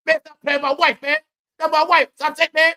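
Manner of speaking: high, loud whiny protest; mock Kevin Hart protest. Kevin Hart begging them to stop mocking his wife, high and whiny. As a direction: imitate him squeaking to stop the jokes.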